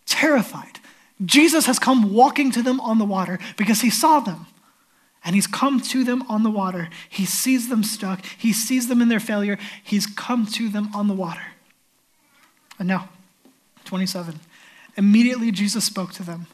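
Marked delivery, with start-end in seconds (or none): none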